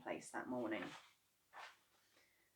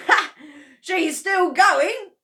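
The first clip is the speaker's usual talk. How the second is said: louder, higher, slow gleeful shout; 11 semitones higher, 14 dB louder, slower than usual. Acted. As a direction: crow with delight as grandma shrinks. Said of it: "shouted Mister Cranky gleefully".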